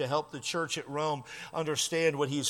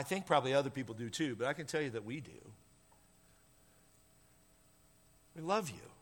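much softer, gentle and personal; tender reassurance; 6 semitones lower, 8 dB quieter, faster than usual. Real, not acted. Pastor to lonely listener, soft tender reassurance. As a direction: lean in and speak softly to the lonely one.

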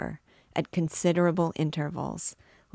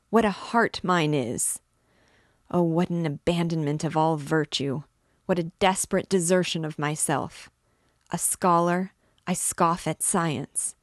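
anguished confession building to a cry; tormented priest; 3 dB louder than usual. Acted. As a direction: pour out guilt, growing louder.

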